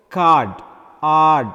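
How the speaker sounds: card, then ard, with a loud held ah; long vowel in card. Shown as a demonstration. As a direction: stretch the ah of card and lean on it. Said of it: "Again, long vowels".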